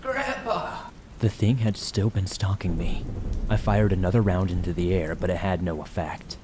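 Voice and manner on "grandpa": very high, drawn mimicking cry; eerie echoed call. A narrator's reading. Unseen thing echoing the hunter's call, eerie high mimicry. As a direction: mimic a lost hunter's yell, higher and not quite human. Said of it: "I heard it again".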